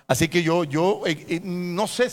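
ordinary talk, nothing marked

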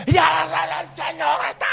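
shouting preacher. Retold or imitated. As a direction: bellow it like a young shouting preacher. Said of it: "Yo era muy gritón".

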